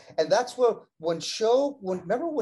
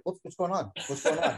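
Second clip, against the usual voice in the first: clueless bewilderment; 5 dB quieter than usual. Retold or imitated. Imitating the wrestler left alone in the ring, mock bewilderment. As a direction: imitate someone left with no idea. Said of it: "Kurt had no idea".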